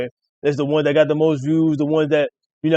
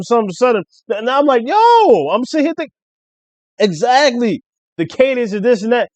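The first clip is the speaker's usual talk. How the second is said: high-pitched, excited recounting; worked-up fan, voice jumping high; hyped retelling; 9 semitones higher, 5 dB louder than usual. Real, not acted. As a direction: relive the rapper's big moment, pitched way up. Hyped retelling of [name]'s bars.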